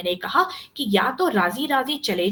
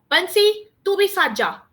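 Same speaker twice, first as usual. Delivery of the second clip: high, raised summoning call; 9 semitones higher, 5 dB louder than usual. A narrator's reading. Uncle summoning Bansi to go along, calling out. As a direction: call the servant over with an order. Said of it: "मैंने पुकारा".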